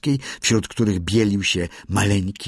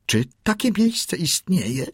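put-on high-pitched young seal's question; lifted, eager little questioner; Kotik asking; 10 semitones higher than usual. A narrator's reading. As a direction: ask it high, like a small creature. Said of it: "spytał Kotik".

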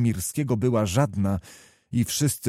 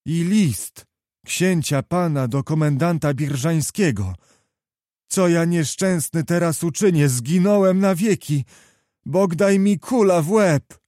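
high, louder cry of ruin; despairing lament; 6 semitones higher, 4 dB louder than usual. A narrator's reading. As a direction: build from alarm to loud despair. Distraught youth clutches his head, laments. (rozpaczliwy lament)

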